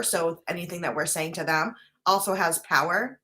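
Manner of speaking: normal talking voice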